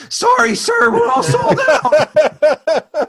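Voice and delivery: put-on very high, slow squeaky teenager; squeaky voice teen. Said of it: "had the same voice as the, uh, the fast food worker".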